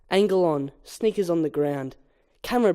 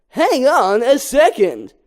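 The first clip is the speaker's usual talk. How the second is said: Barry voiced; 6 semitones higher, 6 dB louder, slower than usual. Barry answers ringing antennae.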